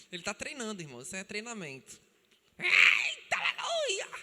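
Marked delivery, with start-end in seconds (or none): 2.6-4.1 high, loud hallelujah cry; playful loud "Eita, aleluia"; mock preacher shout. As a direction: shout it like a little preacher in training. Joking about the child preacher.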